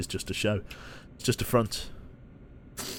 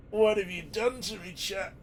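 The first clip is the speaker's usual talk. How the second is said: high, soft words stretched by a yawn; contagious yawn swallowing the words; talking through a yawn; 9 semitones higher, 5 dB quieter than usual. Real, not acted. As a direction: speak while helplessly yawning. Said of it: "it's contagious".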